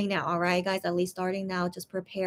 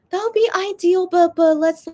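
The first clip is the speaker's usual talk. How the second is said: eager hope; 8 semitones higher, 6 dB louder than usual. Real, not acted. Hopeful reply to fan comment.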